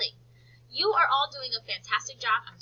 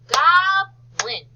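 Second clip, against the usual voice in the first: GOBLIN high and loud, split GOB-LIN; teacher stretching GOBLIN, the GOB held long; syllable by syllable; 7 semitones higher, 11 dB louder than usual. Shown as a demonstration. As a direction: call out GOBLIN slowly in two clear syllables. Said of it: "What's the second syllable?".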